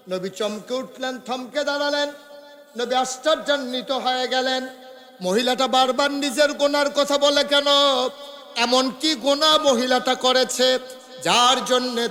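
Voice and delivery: high-pitched chanting sermon delivery; impassioned preaching. A narrator's reading. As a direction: preach it high and urgent, holding line endings. (সুরেলা উঁচু গলায় ওয়াজ)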